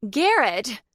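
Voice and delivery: high, loud shout of her son's name. A narrator's reading. Mother yelling at her son to stop. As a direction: yell to stop the fight. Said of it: "his mother shouted".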